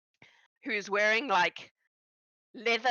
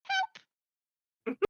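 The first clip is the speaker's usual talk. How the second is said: very high small squeak; tiny squeak for help. Acted. Goblin ending his story, a tiny high plea. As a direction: squeak it out pathetically. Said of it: "Styx goes".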